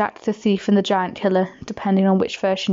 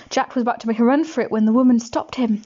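ordinary delivery, nothing marked

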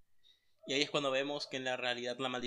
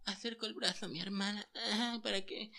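soft, high mock-tragic sister voice; 6 semitones higher, 5 dB quieter than usual. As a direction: parody her dying words, soft and high. Burla de la hermana. (imitación burlona de la hermana, suave y aguda)

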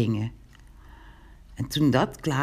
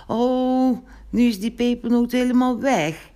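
sighing lament; 9 semitones higher, 4 dB louder than usual. A narrator's reading. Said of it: "zuchtte Pinkeltje".

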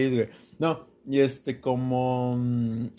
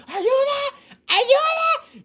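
high, loud cry of ayuda; shouting for help; 4 dB louder, much slower than usual. Retold or imitated. Retelling the mugging, screaming for help in mock panic. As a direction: yell ayuda with full force, much higher. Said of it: "grité".

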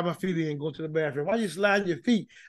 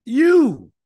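emphatic you; 5 semitones higher, 8 dB louder than usual. Real, not acted. Preacher hammering the point home.

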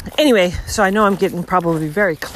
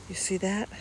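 normal talking voice

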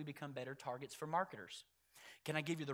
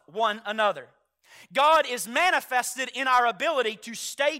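rising, louder preaching to the church; full-force preaching; 8 semitones higher, 20 dB louder than usual. Acted. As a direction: preach it, driving each point home.

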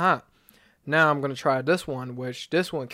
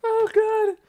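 laughing; 3 dB louder than usual. Real, not acted. Wife suffering hot sauce.

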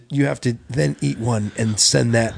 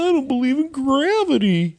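mock confession; 12 semitones higher than usual. Podcast host fakes a cancel-worthy belief, high mocking voice. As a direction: put on a high, goofy voice.

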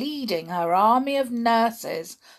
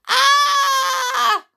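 sudden very high loud scream; terrified high shriek; 10 dB louder than usual. Acted. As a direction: scream in shock. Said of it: "screamed the boy".

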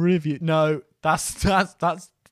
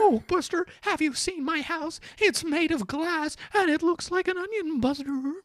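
high, slowish mock voice; mock Buster voice; 14 semitones higher than usual. Host riffing on the film in a high mock voice. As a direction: keep the silly high voice going.

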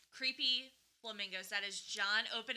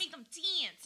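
higher, played-up Delco accent on "dance"; exaggerated regional accent; 7 semitones higher, slower than usual. Shown as a demonstration. Co-host owning her accent slip, exaggerated Delco accent. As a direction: lean into the local vowel in "dance", Delco accent. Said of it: "I'm so sorry for my accent".

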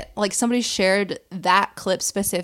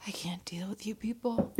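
whispering; 12 dB quieter than usual. Real, not acted. Exasperated aside.

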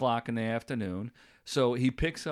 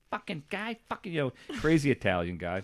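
ordinary talk, nothing marked

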